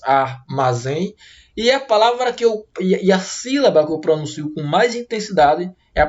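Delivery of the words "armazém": "armazém" slowly as ar, ma, zém; slow, lower-pitched syllable-by-syllable "armazém"; split into syllables. Shown as a demonstration. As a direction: break "armazém" into three separate syllables. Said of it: "Divida a palavra armazém em três sílabas".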